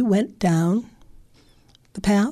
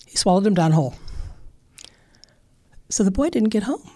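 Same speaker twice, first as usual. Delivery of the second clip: usual speaking voice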